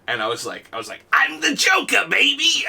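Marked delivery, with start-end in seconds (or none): 1.1-2.6 high, theatrical villain cry; Joker. Acted. Player announces himself before stealing Iron Man, Joker voice. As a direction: crow it as a gleeful comic-book villain. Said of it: "you do a killer Mark Hamill".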